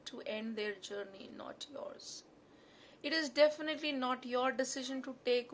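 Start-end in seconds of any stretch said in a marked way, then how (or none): none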